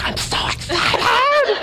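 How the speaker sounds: high, slow, imitating an overjoyed shout; wife squealing with joy before the jump; thrilled squeal. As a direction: mimic a giddy wife bursting with excitement. Voicing Bethany's thrilled reaction.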